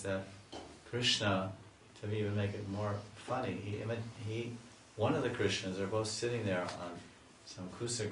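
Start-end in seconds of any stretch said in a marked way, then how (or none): none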